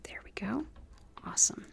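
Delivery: soft whisper to herself. Real, not acted. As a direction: whisper it while working. Quiet aside while placing stones.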